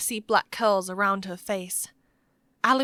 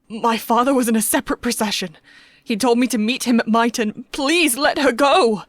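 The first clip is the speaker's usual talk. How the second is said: loud, frightened confession rising to a plea; shaking voice; 3 semitones higher, 7 dB louder than usual. A narrator's reading. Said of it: "Toby's voice shook".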